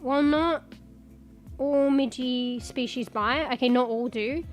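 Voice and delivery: usual speaking voice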